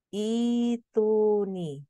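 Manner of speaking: "ituni" stretched out, each syllable sustained and evenly chanted; long-vowel pronunciation. Shown as a demonstration. Teacher showing trainees how it is read after a pause, drawn-out "ituni". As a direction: draw out "ituni" slowly with long held vowels.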